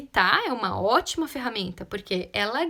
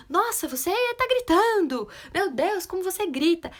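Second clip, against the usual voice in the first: higher, exaggerated astonished exclamation; mock shocked parent; 6 semitones higher, 3 dB louder than usual. Parent reacting to a child's yelling, shocked. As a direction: overreact in dismay, pitch raised.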